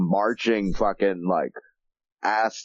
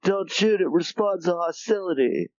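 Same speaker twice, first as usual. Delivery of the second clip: panicky soldier; 10 semitones higher than usual. Retold or imitated. Soldiers warning about the army.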